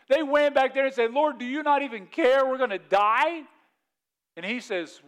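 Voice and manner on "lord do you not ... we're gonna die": high, loud desperate appeal; acted alarm, raised pitch; panicked plea. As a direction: plead loudly with someone who won't wake up. Disciples wake Jesus in storm.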